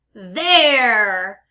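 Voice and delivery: loud, drawn-out pirate "arr" in they're. Shown as a demonstration. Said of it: "like a pirate".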